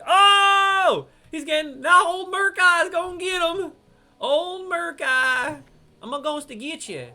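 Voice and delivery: high, very loud game panic; excited high-pitched yelling; alarmed holler. Real, not acted. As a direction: holler out as the boss attacks. Boss attacks teammate mid-sentence.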